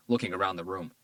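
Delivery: plain reading voice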